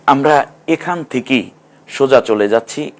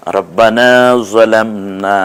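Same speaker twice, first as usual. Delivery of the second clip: ordinary talk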